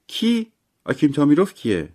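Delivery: high-pitched, surprised query; puzzled question. A narrator's reading. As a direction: ask who on earth that is, voice leaping up. Puzzled by an unknown name. (با تعجب و صدای زیر)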